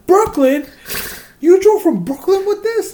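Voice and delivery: very high, loud mock disbelief; incredulous mechanic. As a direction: squeal it like you can't believe your ears. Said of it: "I'm like, "Brooklyn".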